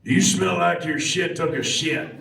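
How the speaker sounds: punchy performed taunt; favorite game line. Acted. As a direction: throw the insult out loud, on the edge of laughing. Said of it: "what is your favorite line".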